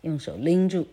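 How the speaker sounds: usual speaking voice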